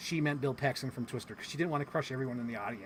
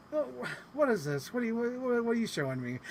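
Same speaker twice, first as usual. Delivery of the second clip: stammering, higher-pitched imitation of a confused actress; agitated; 8 semitones higher than usual. Imitating an actress baffled by a pixel game, agitated. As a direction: trip over the questions, mock flustered. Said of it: "just seemed so agitated".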